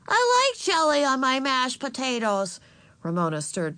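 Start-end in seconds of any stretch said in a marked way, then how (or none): 0.1-2.6 put-on high, louder small-child voice; defiant little girl. A narrator's reading. Little sister talking back to mother, defiant.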